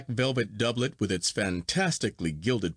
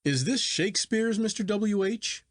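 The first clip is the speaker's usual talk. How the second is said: much higher, astonished question; high, thrilled disbelief; excited cry; 10 semitones higher than usual. A narrator's reading. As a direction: leap up in amazed excitement. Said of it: "I cried".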